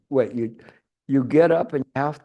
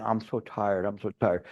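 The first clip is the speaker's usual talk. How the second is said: mock weary moan; 5 semitones lower, 6 dB quieter than usual. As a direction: lower and softer, sighing the words. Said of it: "something that I put into my mind".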